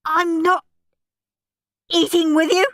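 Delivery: high, loud and halting; stuttering in disbelief. A narrator's reading. Henry shut out of the meal, stuttering incredulously. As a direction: stop midway, as if it can't be true. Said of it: "Henry stuttered".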